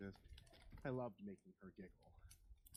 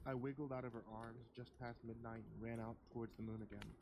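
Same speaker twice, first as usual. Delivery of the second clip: ordinary delivery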